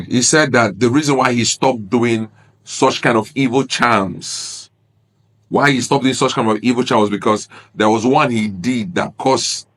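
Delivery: ordinary talk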